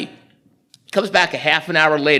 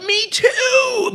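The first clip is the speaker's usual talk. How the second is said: gleeful high exclamation. Retold or imitated. Man laughing about his colostomy.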